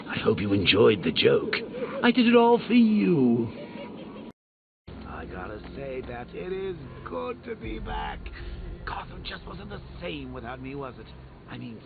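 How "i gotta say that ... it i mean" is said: far-off voice. Acted. Distant or muffled voice.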